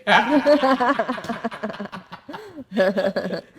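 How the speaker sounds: long loud burst of laughter. Real, not acted. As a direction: laugh out hard at the memory. Laughs at own story.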